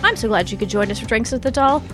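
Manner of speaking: ordinary delivery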